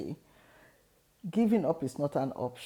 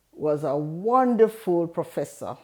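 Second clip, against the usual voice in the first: usual speaking voice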